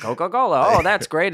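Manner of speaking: put-on loud old-fashioned pitchman; hammy vintage ad voice; old-timey voice. Continues old-timey imitation.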